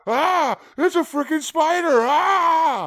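high panicked shriek; spider scream. Acted. Grandpa spots spider, screams.

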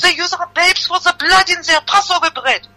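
high-pitched outraged barmaid; crying out. Acted. Barmaid rebukes the child's minder, high cry. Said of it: "cried the barmaid".

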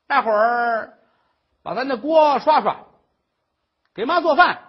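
loud, high nagging tirade; shrill scolding old mother. Acted. Mother-in-law scolds the crying daughter-in-law, loud and high.

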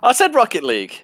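high, loud, stressing the word said; repeat with emphasis. Real, not acted. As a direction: punch the word said. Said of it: "I think Sam spoke over me".

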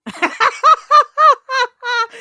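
big high burst of laughter; cracking up at the fart joke; loud laugh. Real, not acted. Co-host bursting out laughing at a fart joke, loud laugh.

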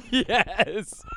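gleeful cackle of victory; gotcha, high whooping laugh; triumphant laugh. Real, not acted. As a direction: laugh out loud, you caught them out. Winning a trick question game.

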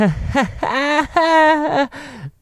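a very high, wailing fake laugh; mock the woman's laugh with a high cry; imitated weird laugh. Imitating the woman who laughed at nothing, high wailing laugh. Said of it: "it was like".

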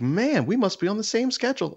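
high, chummy neighbor impression. Mocking the neighbor who times his walks. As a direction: mimic the overly friendly neighbor. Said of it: "And he's like".

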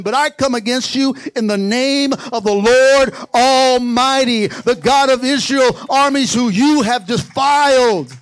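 loud, ringing challenge; forceful declaration. Retold or imitated. David declaring against the Philistine, loud and forceful. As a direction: build it into a loud battle declaration.

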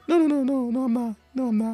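squeaky high, nervous denial; high panicked denial. Retold or imitated. Friend imitating a shy character, high panicked denial. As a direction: protest high and fast like a scared kid. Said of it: "he's like".

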